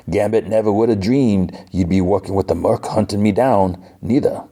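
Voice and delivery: bad put-on Cajun accent; lightly hammed-up Cajun charmer; Gambit. Gambit answers Rogue over dinner, bad Cajun accent. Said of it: "the worst Gambit accent ever".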